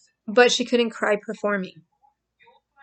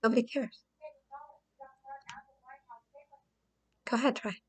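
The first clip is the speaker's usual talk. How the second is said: quiet abuser taunt; 4 semitones higher, 11 dB quieter, faster than usual. Retold or imitated. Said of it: "straight out of the book of an abuser".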